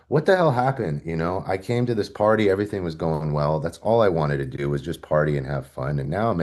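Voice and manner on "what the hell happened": high, dazed disbelief; bewildered waking. Retold or imitated. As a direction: come to and wonder aloud. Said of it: "in the hospital bed going".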